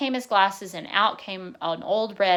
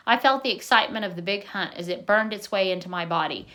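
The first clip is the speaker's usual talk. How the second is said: usual speaking voice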